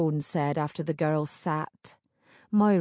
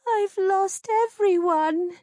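tearful; 13 semitones higher, 4 dB louder than usual. Acted. Tearful heroine, rescue scene.

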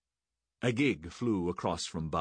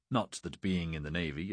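ordinary delivery, nothing marked

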